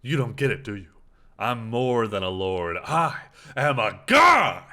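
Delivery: villain voice swelling to a loud shout; booming demon lord. Acted. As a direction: boom out the god's claim, slowing at the end. Voicing the lord, now a god.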